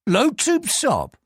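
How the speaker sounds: slow, deliberate repetition; slow checking. Acted. Police superintendent repeats the clerk's name, slow and doubting. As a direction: weigh each syllable of the name.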